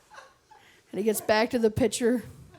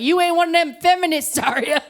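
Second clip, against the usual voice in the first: higher, louder rural Alberta twang; hammed-up rural Alberta voice; rural Alberta accent; 7 semitones higher, 4 dB louder than usual. Shown as a demonstration. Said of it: "I'm doing it wrong".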